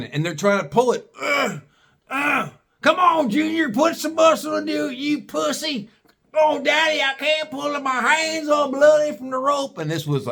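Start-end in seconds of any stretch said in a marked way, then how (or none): 1.2-2.5 high grunts of heaving; mock straining grunt. Straining to pull limb. 2.8-5.9 much higher mock voice; taunting hillbilly father. Father voiced urging son. 6.3-9.8 put-on much higher whimpering kid voice; whining son. Son whines to father.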